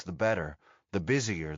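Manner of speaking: ordinary delivery, nothing marked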